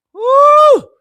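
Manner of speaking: high, loud celebratory whoop; hype whoop. Real, not acted. Host whooping to fire up her viewers, loud and high. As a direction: let out a big excited woo.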